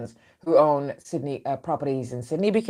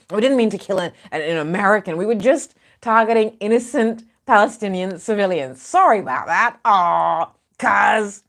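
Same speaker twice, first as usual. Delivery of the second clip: mock apology; 5 semitones higher, 3 dB louder than usual. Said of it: "And now they're like".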